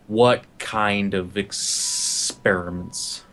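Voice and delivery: very slow, low, creepy; creepy murderer in his face, very slow. Acted. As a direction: draw it out inches from his face. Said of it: "almost a creepy movement".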